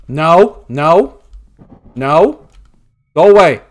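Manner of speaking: loud panicked pleading; being chased. Real, not acted. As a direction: yell at it to go away. Chased by monster.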